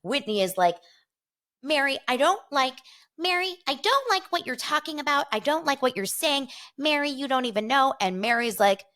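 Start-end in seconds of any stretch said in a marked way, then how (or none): 1.6-7.9 high-pitched imitation of a woman objecting; hammed-up high protest, tripping over restarts; mock quarrel. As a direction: play up an exasperated reality-TV spat. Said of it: "Whitney and Mary are going at it".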